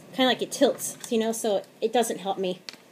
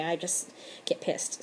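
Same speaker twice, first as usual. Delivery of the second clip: whispered aside; 3 semitones lower, 8 dB quieter than usual. Real, not acted. Quiet aside about anger.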